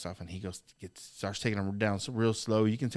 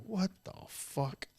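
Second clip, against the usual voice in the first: slow whispered disbelief; 9 semitones higher, 6 dB quieter, much slower than usual. Retold or imitated. Retold disbelief at injury.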